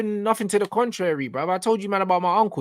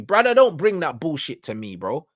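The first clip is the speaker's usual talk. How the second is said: ordinary talk, nothing marked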